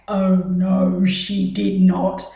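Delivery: loud, slow scandalized gasp of a makeup artist; hammed-up horrified pro; mock shriek. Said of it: "makeup artists will probably shriek and say".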